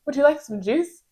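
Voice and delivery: high, bright-voiced offer of juice; sweet offer. Nurse imitating a coworker soothing a yelling patient, sweet and high. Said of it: "then she would say".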